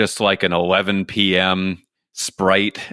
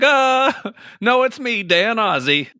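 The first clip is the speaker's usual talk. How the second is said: much higher, playful impostor woman; high mock woman voice; 14 semitones higher than usual. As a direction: squeak it out as a fake Dan. Impostor woman pretends to be Dan.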